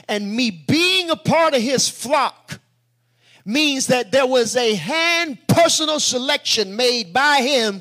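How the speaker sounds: loud, emphatic sermon proclamation; full-force preaching. Acted. Preacher telling the flock of being chosen, full-force preaching. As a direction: draw out the key words and drive them home.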